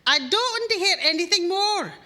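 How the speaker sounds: high, loud, fed-up calling from another room; wife brushing off her husband from the back room; exasperated wife. Acted. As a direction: dismiss him firmly, drawn out. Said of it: "Evie from the back room goes".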